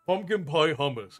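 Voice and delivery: ordinary talk, nothing marked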